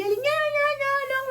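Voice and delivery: put-on very high whiny toddler "nhá nhá" crying; mock toddler wail, high and nasal-free, repeated; imitated toddler whine. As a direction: squeal it like a little kid throwing a tantrum. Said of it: "começou a chorar".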